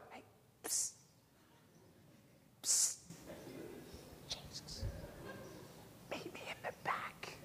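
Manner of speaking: hushed psst to get attention; barely audible, furtive whisper; secretive whisper. Acted. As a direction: whisper as if sneaking someone over. Acting out secretly signalling Jesus.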